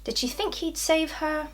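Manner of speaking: high-pitched imitation of the gossips; mock questioning. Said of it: "they ask".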